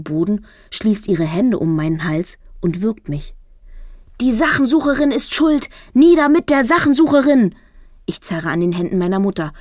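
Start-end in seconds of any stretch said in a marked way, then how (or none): 4.2-7.5 high, loud furious shout; mother yelling while strangling her daughter. A narrator's reading. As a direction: scream the blame at her.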